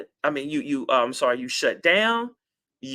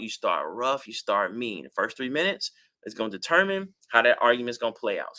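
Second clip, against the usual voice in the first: ordinary talk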